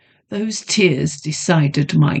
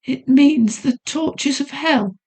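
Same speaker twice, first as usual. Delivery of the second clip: fearful whisper; 8 semitones higher than usual. A narrator's reading. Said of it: "she whispered in slow terror".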